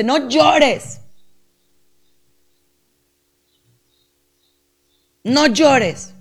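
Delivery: someone telling you not to cry, sharp and high; people scolding. Retold or imitated. Psychologist voicing people who scold a crying listener, sharp. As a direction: snap it like a dismissive relative. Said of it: "que te dice".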